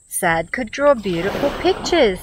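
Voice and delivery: ordinary delivery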